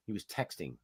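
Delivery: low hushed disbelief; hushed reveal. Retold or imitated. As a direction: drop the voice for the reveal. Dramatic reveal of texting driver.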